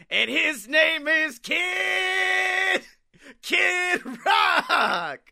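high, slow announcer shout. Acted. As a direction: hype it like a ring announcer. Hyped announcement of [name].

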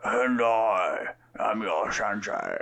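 slow hammed-up heroic dub voice; mock dub voice. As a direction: overact the anime dub line. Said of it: "He was like".

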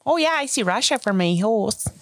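high folksy Midwestern mom; Midwestern accent. Shown as a demonstration. Still doing the Midwestern accent for friends. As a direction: chirp it like Bobby's World mom, Midwestern. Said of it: "You sound just like the mom".

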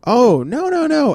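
high, loud sing-song denial; denying girlfriend. Retold or imitated. Girlfriend brushing off breakup rumour, high sing-song. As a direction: squeal the no's up high.

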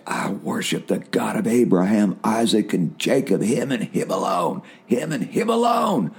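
rising to a shouted proclamation; fervent declaration. Retold or imitated. Preacher retelling his night battle, building to a shout. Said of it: "And I'd pull back and I'd say".

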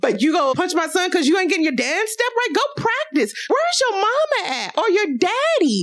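high-pitched mock telling-off; indignant scolding. Host tells off the child who punched her son, indignant.

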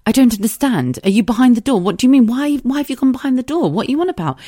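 high, bewildered questions; baffled. Retold or imitated. Wife baffled by her husband's phrase, bewildered. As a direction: pile on the confused questions. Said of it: "I was like".

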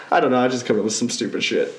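ordinary delivery

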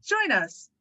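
put-on sales voice for a station plug. Shown as a demonstration. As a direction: sell it like a promo announcer. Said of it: "that sales voice that you used earlier".

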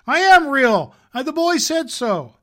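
desperate protest. Acted. Little rabbit protesting to the wild rabbit, high and loud. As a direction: fight back tears and insist.

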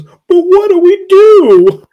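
very high, loud, slow plaintive wail; hammed-up panic from the bookcase guy; desperate wail. Bookcase guy begging the group, high drawn-out wail. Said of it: "The guy behind the bookcase goes".

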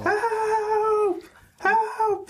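high mock screams; screaming for help. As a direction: keep crying out as you flee. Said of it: "they're running and screaming".

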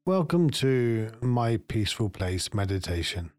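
calm meditation guide, long pauses between phrases; guided meditation. Acted. Meditation guide settling listeners into their peaceful place, guided meditation voice. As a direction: lead them gently inward, unhurried, leaving space after each phrase.

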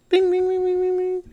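high sung ding ding of a quiz bell; game show bell. Pretend. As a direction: chime it like a correct-answer bell.

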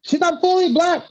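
sing-song reassurance to Jenny Slate; lightly mocking voice. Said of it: "I was like".

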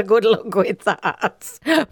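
slow, sarcastic send-off through laughter; drawn out, laughing at your own sarcasm. Shown as a demonstration. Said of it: "Mine often comes out quite sarcastic".

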